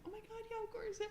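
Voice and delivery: excited. Retold or imitated. Young girl agreeing eagerly with her dad, high squeal. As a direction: squeal along with dad's plan. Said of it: "I'm like".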